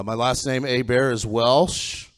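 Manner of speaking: normal talking voice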